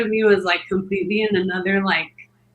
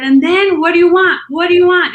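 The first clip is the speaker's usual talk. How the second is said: loud, high, exasperated demand; heated, raised-pitch challenge; retold yell at husband; 8 semitones higher, 7 dB louder than usual. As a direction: imitate barking a question at your husband. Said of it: "I yelled at him".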